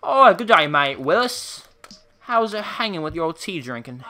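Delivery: hammed-up British greeting; mock British accent. Streamer greeting viewer Willis, mock British accent. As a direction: greet them as a cartoon Brit. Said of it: "the most coolest Britain name".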